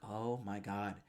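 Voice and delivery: low, hushed swoon; soft, low mock-aroused awe; turned on. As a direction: murmur it softly, imitating an impressed admirer. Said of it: "super turned on by it".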